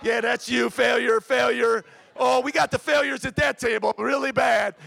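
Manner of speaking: loud, high-pitched teasing shout to the crowd; calling out. Real, not acted. Pastor teasing the husbands in the audience, calling out loudly. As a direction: holler it across the room at them.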